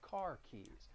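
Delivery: ordinary talk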